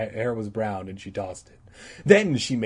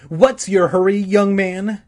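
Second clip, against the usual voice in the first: higher, loud sharp scolding; snapping; 10 semitones higher, 9 dB louder than usual. Acted. Teacher snapping at the boy who bumped her, loud. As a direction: snap it at him. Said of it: "she snapped at Todd".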